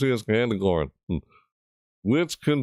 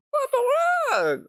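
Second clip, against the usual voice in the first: high, slow squeak of disbelief; squeaky what the heck; slower than usual. Real, not acted. Streamer baffled by the trailer, squeaky disbelief.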